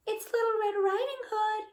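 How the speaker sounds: put-on high, sweet little girl voice from a wolf; the wolf faking a little girl; wolf pretending to be a little girl at the door; little girl's voice. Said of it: "called the wolf in a little girl's voice".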